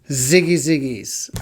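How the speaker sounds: slow silly made-up sign-off; goofy sign-off voice. Pretend. Host signing off viewers with a goofy catchphrase. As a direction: drawl it like a playful catchphrase.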